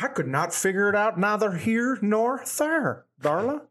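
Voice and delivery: slow high Southern voice; southern drawl. Retold or imitated. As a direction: stretch it out, country style. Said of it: "the Southern accent, it's so slow".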